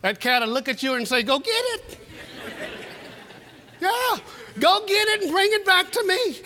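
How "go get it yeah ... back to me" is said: bossy cat. Acted. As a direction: order them around like a spoiled cat. Bossy cat ordering owner.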